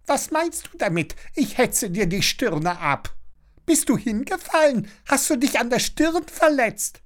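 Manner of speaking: very high, fretful busybody; high-pitched concerned prattle; worried chattering neighbour. A narrator's reading. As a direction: fuss over the child anxiously. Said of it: "Ihre Stimme klingt besorgt".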